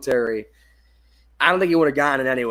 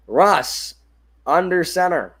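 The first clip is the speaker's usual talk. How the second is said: lively sportscaster call, a bit higher and louder; play-by-play call; 4 semitones higher, 5 dB louder than usual. Acted. Calling the Giants drive for his viewers, live play-by-play. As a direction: call the handoff like a live broadcast.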